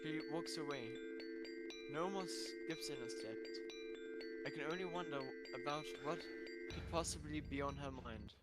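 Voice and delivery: soft, wistful storytelling; subdued story narration. A narrator's reading. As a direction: narrate quietly, letting the hurt linger. Soft narration after sad scene.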